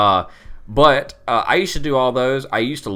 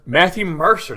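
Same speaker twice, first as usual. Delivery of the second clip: excited; 11 semitones higher, 8 dB louder than usual. Real, not acted. Recognizing the name.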